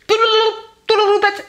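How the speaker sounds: squeaky high nonsense word; tossing off a silly made-up word in a high voice; playful high singsong. Real, not acted. Vlogger naming her topknot in a playful high voice.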